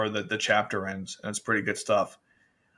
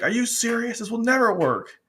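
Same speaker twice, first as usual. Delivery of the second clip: high-pitched incredulous retort; acted disbelief; 10 semitones higher than usual. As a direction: play someone scoffing at a hopeless plan, pitched up. Acted incredulous reply to the speech.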